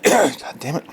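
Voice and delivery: usual speaking voice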